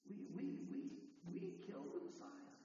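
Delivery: a stunned hushed confession; stricken whisper. Acted. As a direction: gasp it out as the guilt lands. Crowd realizing their guilt.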